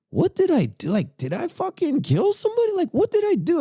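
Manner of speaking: high-pitched retold panic; panicked bewilderment. Recalling his thoughts as agents raid him, high bewildered panic. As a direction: voice jumps way up, racing through frantic questions.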